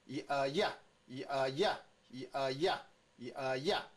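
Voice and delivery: 一，呃，呀 in three steps, last sound punched; sound blending. Shown as a demonstration. Teacher leads the next one, blending drill. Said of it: "再来".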